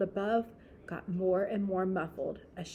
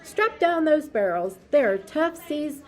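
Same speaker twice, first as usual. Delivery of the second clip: sailors calling out; 5 dB louder than usual. Acted. Busy sailor calls to the crew, loud. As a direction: bark the orders over the noise.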